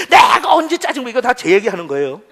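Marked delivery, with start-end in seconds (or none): 0.1-1.1 loud, high defensive snap; irritated denial. Acted. Husband answering wife who calls him irritable, indignant snap.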